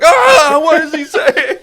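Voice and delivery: high, loud hammed-up panic; mock panicked scream. Kidnapped locals hearing Pennsylvania German, mock panicked scream. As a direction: shriek in comic bewilderment. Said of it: "Just screaming and having, like, a fucking panic attack".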